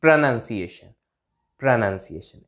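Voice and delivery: low प्रोनंसिएशन with 'nun' in the middle; correct pronunciation. Shown as a demonstration. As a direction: model प्रोनंसिएशन as pruh-NUN-siation. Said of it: "ऐसे करके इसको बोलेंगे". (बीच में 'नन', सही उच्चारण)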